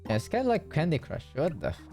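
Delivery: normal talking voice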